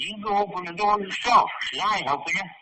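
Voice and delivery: loud, high, defiant refusal; mother-in-law refusing the police, angrily; angry retort. Retold or imitated. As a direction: throw the refusal back at them. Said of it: "she was pissed".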